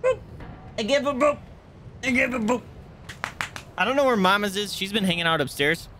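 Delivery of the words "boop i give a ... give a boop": dog voice. Acted. Playing with dog.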